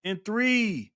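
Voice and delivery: loud, high game-show announcer build-up; hyped countdown. Real, not acted. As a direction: rev up the crowd, rising to the spin. Hyped countdown to the final spin.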